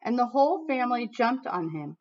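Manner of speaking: lively read-aloud storytelling voice; reading to children. Acted. Teacher reading a picture book to her class, lively storytelling.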